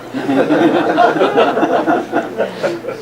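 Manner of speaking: a light laugh under the breath. Real, not acted. Keeps laughing after the comment.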